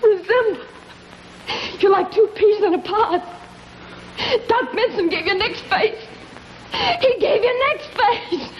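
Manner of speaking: high, laughing ridicule; shrill, gleeful mockery through laughs; mocking laughter. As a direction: laugh in his face as you say it. Mocking him about his face.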